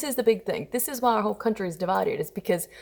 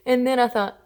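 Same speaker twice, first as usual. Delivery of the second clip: ordinary talk, nothing marked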